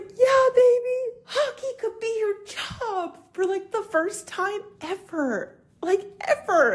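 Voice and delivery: high, tearful voice breaking with emotion; choked up, telling her daughter through tears; crying. Real, not acted. Mother tearfully answering her daughter, crying. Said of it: "And then I started crying".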